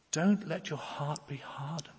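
whispered plea, urgent and close; pleading whisper. Real, not acted. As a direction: whisper it as a heartfelt warning. Pleading with the congregation.

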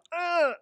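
sudden high grunt; acted pained grunt. Preacher showing how sin should feel, acted pained grunt. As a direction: jolt out a mock grunt of conscience. Imitated jolt of conscience.

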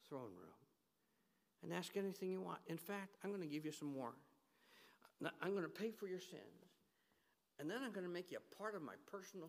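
soft, intimate fatherly promise, fading almost to a whisper; tender God voice. Acted. God speaking tenderly to his children, soft and fatherly. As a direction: coax them close like a loving father.

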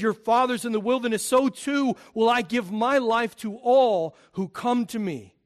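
Jesus offering himself to the crowd, preached loud; preaching Jesus' words. Acted. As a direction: plead with the hearers as Jesus.

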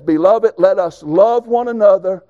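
slow, loud solemn reading of scripture. Acted. Preacher reading verse seven aloud to the congregation, slow and solemn. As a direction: read it slow and weighty. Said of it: "Look at what he says".

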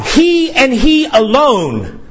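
slow, high, every word of he and he alone weighted; emphatic stress. Shown as a demonstration. Preacher stressing that Christ alone saves, slow and high. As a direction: lean hard on he and he alone. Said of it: "there's an emphatic emphasis here".

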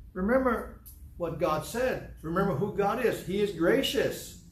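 fervent sermon delivery; fervent preaching. Acted. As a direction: drive the point home to the congregation. Preaching at full force.

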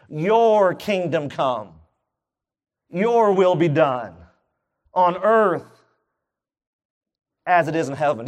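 slow, weighty prayer reading; slow reverent recitation. Acted. Preacher to congregation, reciting the prayer slowly and reverently. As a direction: lift each phrase up slowly to God. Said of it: "reciting the Lord's Prayer".

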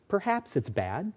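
calm farmer. Acted. Wise farmer replies calmly.

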